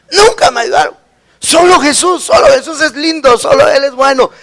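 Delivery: a hint of the old woman finding faith; grandmother's confession. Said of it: "Así me dijo".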